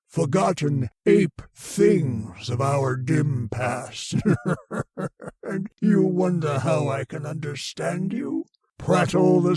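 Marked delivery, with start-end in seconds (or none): 4.2-5.4 high, contemptuous laugh; scornful alien laugh. Acted. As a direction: laugh at the ape things. Alien head laughs at humans.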